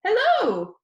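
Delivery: high, squeaky, cheerful greeting; high squeaky boy. Acted. Danny greets his YouTube viewers in a high squeaky voice. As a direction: chirp it out like an excited kid. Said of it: "His voice was high and squeaky".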